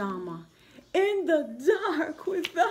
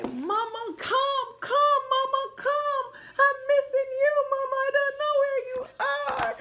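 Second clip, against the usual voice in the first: put-on much higher crying baby voice; screaming baby; 10 semitones higher, 4 dB louder than usual. Baby llama screaming for mama to come. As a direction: wail for mama like a lost toddler. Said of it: "he's screaming on top of his voice".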